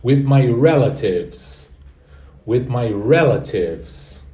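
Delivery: slow, careful teacher model of "With my relatives"; slow model phrase. Shown as a demonstration. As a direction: spell out "With my relatives" slowly for the class. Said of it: "with my relatives quer dizer com meus parentes". (frase modelo lenta e cuidadosa)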